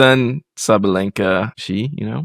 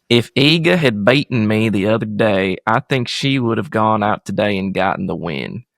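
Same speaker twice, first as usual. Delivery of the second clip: put-on Southern accent. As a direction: play the tennis player as a Southerner. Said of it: "I can do a Southern accent".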